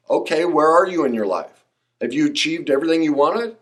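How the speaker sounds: ordinary talk, nothing marked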